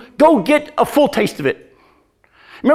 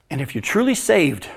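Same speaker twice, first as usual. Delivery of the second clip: usual speaking voice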